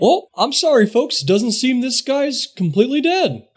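high-pitched, put-on doctor voice; intruding doctor character. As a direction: butt in with a mock apology.

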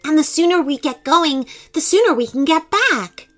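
high, eager elf voice; grinning confidence. Acted. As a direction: urge them on through a grin. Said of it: "grinned Harrison".

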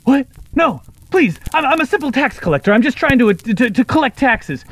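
high, loud, panicked pleading in a parroted voice; a bird squawking back a frightened taxman; kenku mimicking tax collector. Kenku replays the tax collector begging for mercy, high and loud. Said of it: "opens its mouth and says".

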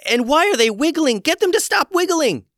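high frantic shriek; panicked. A narrator's reading. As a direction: squeal it in panic. Gwen panics at toes.

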